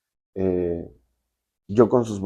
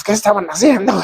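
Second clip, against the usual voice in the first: imitated suspicious boyfriend, pitched much higher; mock accusing jealous question; a hammed-up jealous demand; 9 semitones higher, 4 dB louder than usual.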